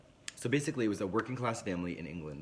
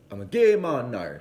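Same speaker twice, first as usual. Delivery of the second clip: louder, firm restated confession; insistent son; 3 semitones higher, 10 dB louder than usual. Retold or imitated. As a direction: say it again, louder and firmer. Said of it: "he says it again, and he says it very".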